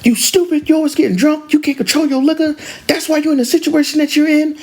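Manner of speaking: high-pitched angry scolding; going off. Retold or imitated. Father berating his drunk daughter, going off. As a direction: imitate a father who has lost it. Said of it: "And the father went off".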